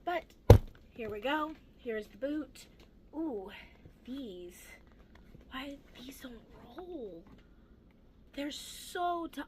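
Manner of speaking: ordinary delivery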